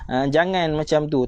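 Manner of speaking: ordinary talk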